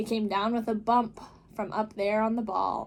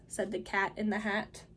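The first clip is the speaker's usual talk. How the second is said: ordinary delivery, nothing marked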